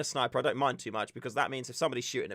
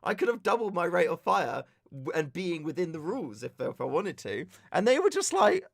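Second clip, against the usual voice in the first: ordinary delivery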